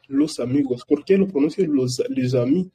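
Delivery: usual speaking voice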